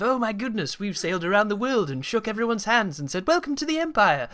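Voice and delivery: high-pitched mock wonder at glorious empire; romanticised retelling. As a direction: imitate a rosy-eyed tale of friendly explorers. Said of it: "it was all romanticised".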